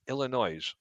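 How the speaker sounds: Illinois said with a buzzing s at the end. Shown as a demonstration. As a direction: pronounce Illinois as ill-in-OYZ. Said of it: "they enunciate the S at the end".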